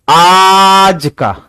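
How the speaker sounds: आज held long and punched loud; emphatic stretched आज. Shown as a demonstration. Teacher revealing today's price per kilo, आज loudly stretched. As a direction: draw आज out forcefully before the number. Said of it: "जोर से बोलो, आज".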